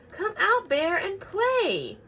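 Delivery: high, loud, coaxing call; calling friends. Acted. Friends call to sick Bear, high and loud.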